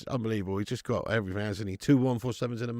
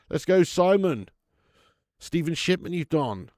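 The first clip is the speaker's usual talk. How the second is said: ordinary delivery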